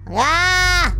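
high, playful yelp of alarm; high scream. Pretend. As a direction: let out a high mock scream. Mock scream at game scare.

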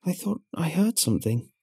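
put-on girl's voice. Girl's voice in story.

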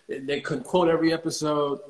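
ordinary talk

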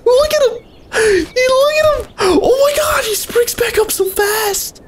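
very high, squealing; excited shriek. Real, not acted. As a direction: squeal with disbelief. Shrieking at the bouncing gardener.

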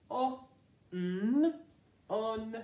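W, I, n said apart and lifted, then "Win"; a phonics teacher sounding out "Win"; sounding out then blending. Shown as a demonstration. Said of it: "Sound this out with me".

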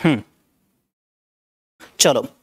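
usual speaking voice

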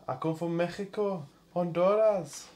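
high-pitched mock Mexican accent; Mexican accent impression. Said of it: "Can you do a Mexican accent?".

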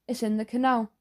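ordinary delivery

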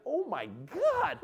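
high, drawn-out awe, hammed up; mock amazement. Comedian watching dancers levitate, mock amazed. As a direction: stretch it out high, imitating astonishment.

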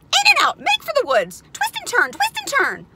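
very high, loud frantic goose; high-pitched shouted goose orders; goose crying orders. A narrator's reading. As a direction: shriek commands like a bossy goose. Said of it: "began to give orders".

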